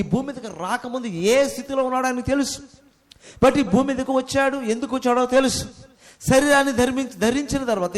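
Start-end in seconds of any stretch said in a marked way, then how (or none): none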